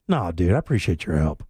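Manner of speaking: low, laid-back thanks; very mellow. Retold or imitated. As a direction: let it drift out low and easy. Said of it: "it was just very mellow".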